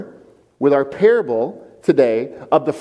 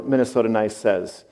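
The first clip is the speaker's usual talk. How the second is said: usual speaking voice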